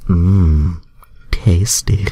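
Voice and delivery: loud, hammy sultry purr; exaggerated mock-seductive voice; hammed-up Rogue. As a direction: overplay the seductive character for laughs. Voicing Rogue in the fanfic.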